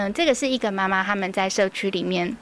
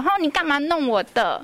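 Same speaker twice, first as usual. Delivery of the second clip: a mock-annoyed child's complaint, pitched up; 模仿孩子生气; 6 semitones higher than usual. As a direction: mildly imitate a cross child, higher pitch. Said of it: "他们是会生气". (轻微模仿孩子不高兴地抱怨)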